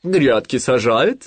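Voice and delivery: loud, slow owl voice; questioning owl. A narrator's reading. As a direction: probe slowly as the owl. Сова расспрашивает Винни. (громко и медленно, голосом совы)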